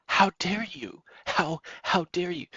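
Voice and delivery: high, hammed-up indignant "how dare you"; mock offended churchgoer. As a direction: parody a prissy, scandalized objector. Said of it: "And then they might say".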